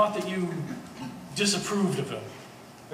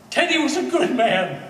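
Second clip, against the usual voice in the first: raised, high and loud, slowing on the middle phrases; impassioned defense; 8 semitones higher, 7 dB louder than usual. Acted. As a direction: fight for his memory at full voice. Defending dead Teddy to his mother.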